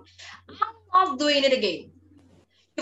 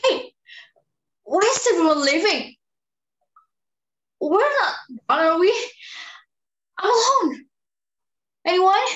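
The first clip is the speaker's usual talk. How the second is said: calling out; 8 semitones higher, 5 dB louder than usual. Acted. Abandoned in studio, calling out.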